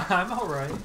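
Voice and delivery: laughing. Real, not acted. Laughs while reassuring teammates.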